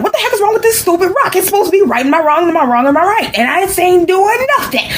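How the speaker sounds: high-pitched indignant outburst; mad. Acted. Bobby complaining about the magic rock, mad. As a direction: rant at the useless thing in a high, exasperated squeal. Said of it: "And Bobby got mad".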